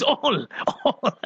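amused, laughter spilling over the words; laughing. Real, not acted. Host reading a little girl's demand for all volumes, laughing. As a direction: break into laughter on the word.

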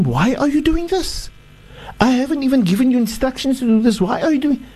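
high-pitched, indignant demand; agitated Pharaoh. Acted. Pharaoh demands to know why the magicians bowed, agitated and high. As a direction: let Pharaoh's outrage climb high in the voice. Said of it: "he became so agitated".

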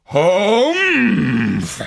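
loud disgruntled huff; giant's humph. Acted. Giant grunts.

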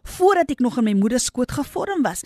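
fervent pulpit cry; preaching at full force. Acted. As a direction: build the sermon to a climax.

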